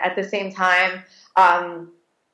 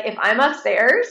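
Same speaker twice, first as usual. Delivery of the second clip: normal talking voice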